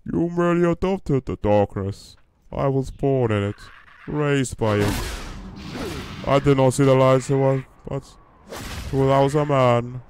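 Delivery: posh villain monologue, pitched high; Bane. Acted. Streamer quoting a film villain's speech, high posh Bane impression. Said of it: "That's how Bane talks".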